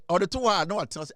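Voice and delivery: ordinary delivery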